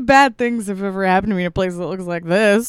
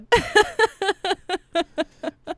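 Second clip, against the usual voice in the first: witch cackle; 11 semitones higher than usual. Real, not acted. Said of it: "hear me cackling".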